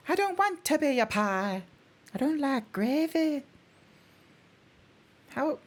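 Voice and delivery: high, cute little voice; a high, cute little whine; cutie voice. Pretend. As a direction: squeak it out like a tiny sweet creature. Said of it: "like, a cutie voice".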